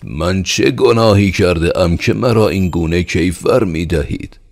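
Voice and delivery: loud, high cry of protest; shouting. Acted. Peasant shouting at the steward who takes his grain. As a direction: shout out against the unfair punishment. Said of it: "فریاد زد و گفت".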